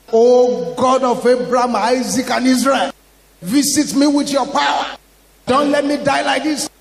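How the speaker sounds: loud, desperate prayer shout; crying out loud and clear. Retold or imitated. Dying woman begs God to save her, crying out loud. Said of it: "she cried loud and clear".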